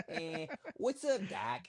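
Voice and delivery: high, slow cartoon rabbit drawl; Bugs Bunny. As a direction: toss off a cartoon rabbit's line in a New York accent. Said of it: "he's gonna do a Bugs Bunny accent".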